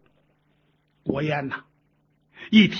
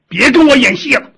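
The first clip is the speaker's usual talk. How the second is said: higher, louder confrontational line; accusing challenge; 5 semitones higher, 7 dB louder than usual. A narrator's reading. Visitor tells impostor to drop the act, raised accusing voice. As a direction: call the bluff forcefully.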